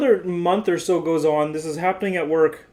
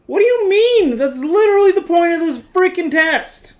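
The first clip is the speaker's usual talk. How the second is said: much higher, louder indignant retort; angry outburst; 12 semitones higher, 6 dB louder than usual. Retold or imitated. Patient snapping at the stress-test doctor, indignant and loud. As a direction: fire it back in disbelief at the doctor. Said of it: "I'm mad now".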